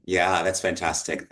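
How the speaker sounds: ordinary talk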